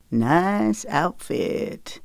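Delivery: slow, exaggerated country twang drawl. A narrator's reading. Teasing her about her nightshirt in an exaggerated country twang. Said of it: "he drawled in an exaggerated country twang".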